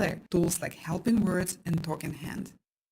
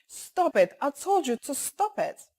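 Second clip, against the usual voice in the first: higher-pitched, insistent demand to stop; fighting voice; 7 semitones higher than usual. Shown as a demonstration. Child telling another kid to stop, fighting voice. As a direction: snap it like a child ready to fight. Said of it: "use the fighting voice and the fighting face".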